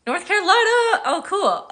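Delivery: high, loud excited exclamation; light up at a shared home state; delighted surprise. Real, not acted. Delighted reaction to viewer's comment.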